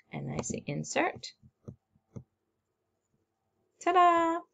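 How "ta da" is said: high, drawn-out reveal; playful ta-da. Real, not acted. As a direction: present it with a high-pitched flourish. Revealing the inserted puppy picture.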